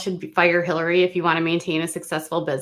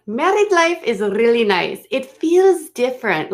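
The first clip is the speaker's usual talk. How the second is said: hammed-up imitation of a fake Spanish-tinged accent; mocking fake accent; 5 semitones higher than usual. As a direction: parrot her fake accent back, exaggerated. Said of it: "What the hell is that accent?".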